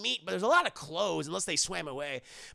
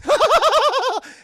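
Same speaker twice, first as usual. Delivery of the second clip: loud, high villain laugh; hammed-up evil laugh; evil cackle; 15 dB louder than usual. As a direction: cackle in triumph.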